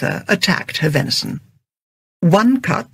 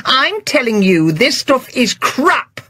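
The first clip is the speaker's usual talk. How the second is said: overloud; 8 semitones higher than usual. Acted. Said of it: "Her voice had been overloud".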